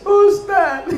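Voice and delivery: high-pitched mock crying call; tearful wail. Weeping woman calls on the preacher, mock tearful wail. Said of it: "Si ibu nangis".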